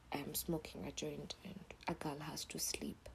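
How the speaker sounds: soft unvoiced whisper; hushed late-night confession. Real, not acted. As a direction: whisper it like a guilty secret. Confessing softly.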